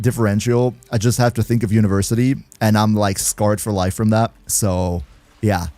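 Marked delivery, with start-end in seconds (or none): none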